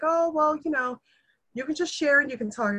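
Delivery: a hint of a carefree interviewer brushing off questions; breezy interviewer. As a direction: toss it off casually like the interviewer.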